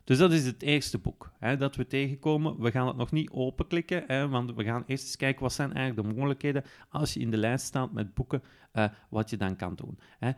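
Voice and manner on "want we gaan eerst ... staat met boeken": ordinary delivery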